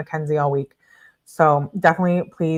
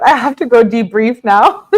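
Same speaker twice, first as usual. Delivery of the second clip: higher, louder, almost cracking up; overwhelmed outburst; 5 semitones higher, 6 dB louder than usual. Real, not acted. Host to viewers after a wild reading, overwhelmed outburst. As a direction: burst out at the edge of laughter.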